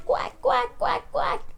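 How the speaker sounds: high-pitched shouted duck quacks; shouted quacking. A narrator's reading. Richard calling to the ducks, high shouted quacking. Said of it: "diye bağırıyormuş Richard".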